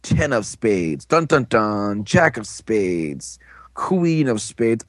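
drawn-out, falling suspense delivery; slow reveal. Retold or imitated. Storyteller acting out Joe's card reveal, slow and suspenseful. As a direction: reveal each card slowly, stretching it out. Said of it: "does a little slow reveal".

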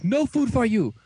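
high-pitched, hammed-up refusal; mock anger. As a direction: scold like a mad cook in a retelling. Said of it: "Doc's all mad".

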